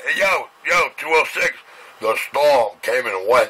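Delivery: usual speaking voice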